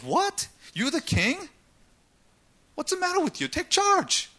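scornful queen. Retold or imitated. Jezebel taunting her husband Ahab.

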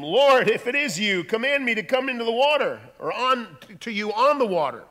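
loud, high-pitched dramatic shout; calling out. Acted. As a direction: shout to someone across the water. Peter calls to Jesus from boat.

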